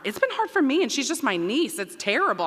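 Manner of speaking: high-pitched, half-joking lament; emotional confession. Real, not acted. Dean telling parents drop-off was hard, emotional confession. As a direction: let the voice leap up in comic distress. Admits drop-off upset her too.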